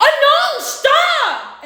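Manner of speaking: high, loud panicked scream, retold in full force; piercingly high acted shriek. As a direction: scream it like a crash is coming. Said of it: "Let me not scream like that".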